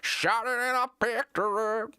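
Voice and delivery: high, twangy mock country singer; generic country singer. Streamer mocking country singers who sound alike, high drawn-out twang. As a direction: draw out a put-on country twang. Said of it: "They'll have, like, that same exact voice".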